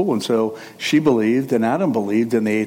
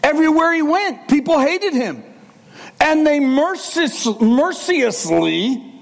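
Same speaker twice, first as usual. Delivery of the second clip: preaching; 13 semitones higher than usual. Acted. Preacher at high pitch.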